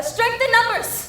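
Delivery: slow, high-pitched slogan; rallying cry. Acted. Newsie offering a slogan to rally the strikers, rousing.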